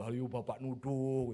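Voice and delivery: slow, drawn-out mock chant; teasing schoolkids. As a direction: imitate pupils taunting their teacher. Class teases the accusing teacher.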